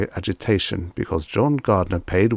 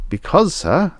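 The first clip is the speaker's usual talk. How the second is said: higher, scared clerk; frightened; 10 semitones higher, 4 dB louder than usual. Acted. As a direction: answer timidly, afraid. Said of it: "the clerk answered in a frightened voice".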